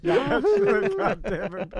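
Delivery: cracking up mid-curse, slow and high; laughing. Real, not acted. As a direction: laugh the words out helplessly. Laughing hard at his own story.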